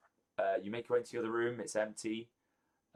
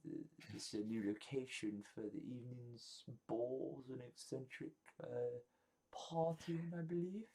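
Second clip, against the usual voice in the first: high, hesitant guest voice, fading softer; inquisitive party guest; 4 semitones higher, 5 dB quieter than usual. Acted. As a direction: pipe up high and politely puzzled, then trail softer. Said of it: "a few people asking".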